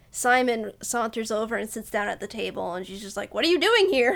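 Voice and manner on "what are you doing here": startled question. Acted. Acted startled question on a date.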